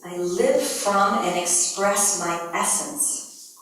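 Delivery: slow, deliberate affirmation; measured intonation of the statement. Shown as a demonstration. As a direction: read it slowly and clearly. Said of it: "One. Two".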